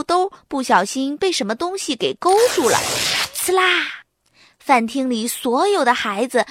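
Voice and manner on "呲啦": ripping sound. Acted. Pants tearing sound.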